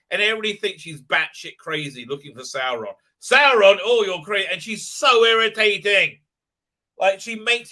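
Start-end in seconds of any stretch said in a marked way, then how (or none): 3.2-4.5 loud sneering mock complaint; mocking whine. Soldier mocking Galadriel to her face, loud sneer.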